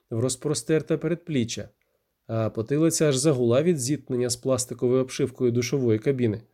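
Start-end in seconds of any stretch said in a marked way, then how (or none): none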